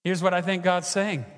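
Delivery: ordinary talk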